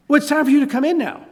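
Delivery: dad insisting Calvin come in now; firm dad. Retold or imitated.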